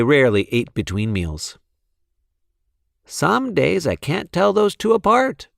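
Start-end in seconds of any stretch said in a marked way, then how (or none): 3.1-5.5 higher, a hint of an amused mom; mild mother voice; lightly voiced mother. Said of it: "said Wilson's mother".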